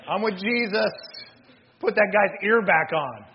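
hammed-up, loud announcement of allegiance; mock soldier's declaration. Soldier declaring he's with Jesus after the ear miracle, mock-emphatic. Acted soldier switching sides in awe.

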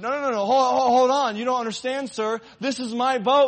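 high, loud, urgent protest; pleading boy. Acted. Boy protesting to the manager that the boat is his, urgent. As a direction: insist desperately it's yours.

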